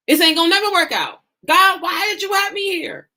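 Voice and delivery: high-pitched mock exasperated wail; acted frustration. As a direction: complain to God, pitch shooting up. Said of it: "Right at that moment of frustration".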